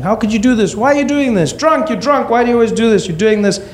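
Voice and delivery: mock mother's shrill telling off; imitating mom shouting. Mom yelling at drunk husband, high mock shriek. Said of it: "my mom was shouting".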